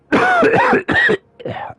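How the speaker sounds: harsh hacking cough; a rough real coughing fit; dry cough. Real, not acted. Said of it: "tô com tosse ainda". (tosse seca)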